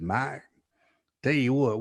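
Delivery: lightly imitated Texan recruiter; Texan accent. Coach making his pitch to a recruit, light Texan accent. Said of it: "He had that accent".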